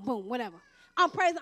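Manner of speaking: usual speaking voice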